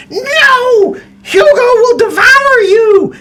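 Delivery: high, loud crying hag threat. Acted. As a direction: wail it like a desperate witch. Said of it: "and she says".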